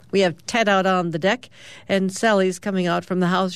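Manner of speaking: usual speaking voice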